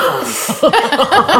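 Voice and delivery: high, bubbling laugh at her own fright; nervous giggle after the memory; burst of laughter. Real, not acted. Recalling scary moment.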